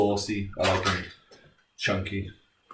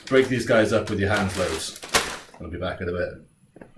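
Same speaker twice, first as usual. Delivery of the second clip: ordinary talk, nothing marked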